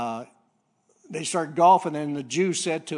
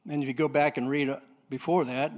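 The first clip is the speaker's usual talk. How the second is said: usual speaking voice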